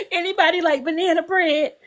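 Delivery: sweetly offering food; emotional auntie. Retold or imitated. Voicing the emotional auntie offering banana bread, mild. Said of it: "emotional auntie who be like".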